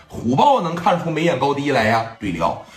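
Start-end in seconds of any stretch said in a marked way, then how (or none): none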